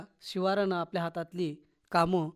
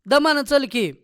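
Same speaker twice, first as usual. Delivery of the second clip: calling out in caution; 7 semitones higher, 7 dB louder than usual. A narrator's reading. Village women to the hurrying woman ahead, calling out. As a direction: call after someone hurrying ahead, louder. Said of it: "तिच्या महागल्ल्या हटकीतच होत्या".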